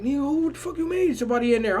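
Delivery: high, fast, rattled questioning; nervous. Retold or imitated. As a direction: blurt it in a high, jumpy rush. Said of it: "I'm getting nervous and shit".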